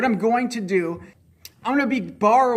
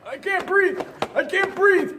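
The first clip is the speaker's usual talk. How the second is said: high strained voice; 11 semitones higher than usual. Real, not acted. Vest too tight to breathe.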